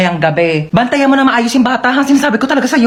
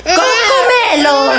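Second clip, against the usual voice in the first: excited call; 10 semitones higher, much slower than usual. Acted. Calling the show to baby.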